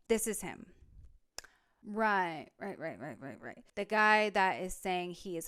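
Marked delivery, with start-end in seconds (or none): 1.8-3.6 low, soft repeated muttering; sarcastic agreement, sinking to almost nothing; muttered dismissal. Real, not acted. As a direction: mutter it under your breath, trailing away. Sarcastic reaction to commenter's photo.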